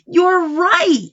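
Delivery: plain reading voice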